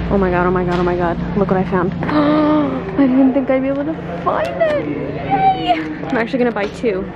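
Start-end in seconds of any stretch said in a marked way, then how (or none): 1.4-4.9 excited gasp. Real, not acted. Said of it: "how happy that just made me". 4.9-5.7 high excited squeal of joy; squealed yay. Real, not acted. Shopper squealing over a found product. Finds wanted yogurt in store.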